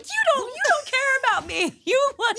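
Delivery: loud, high-pitched bratty wail; shrieky imitation of an outraged child; mock child tantrum. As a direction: throw a hammed-up kid's fit at mom. Acts out childhood tantrum at mom.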